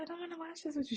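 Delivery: put-on high, soft childish voice; daughter pouting. Mom imitating her daughter's pout, high and soft. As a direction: sulk like a little girl. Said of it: "She like".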